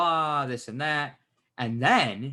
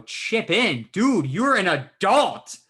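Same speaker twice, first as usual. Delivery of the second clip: louder, higher, drawn-out exasperation; incredulous outburst; 6 semitones higher, 7 dB louder, much slower than usual. Retold or imitated. Telling friends how they scolded the driver, loud and incredulous. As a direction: stretch it out loud in retold disbelief.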